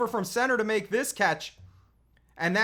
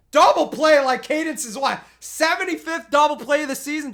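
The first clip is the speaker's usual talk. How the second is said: excited; 5 semitones higher, 8 dB louder than usual. Real, not acted. Praising a double play.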